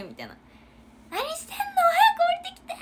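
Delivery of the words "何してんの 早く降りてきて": very high, loud half-laughing yell; retold yell. Captain screaming at late juniors, high and laughing. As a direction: scream at them to hurry, cracking into laughter. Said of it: "叫んで".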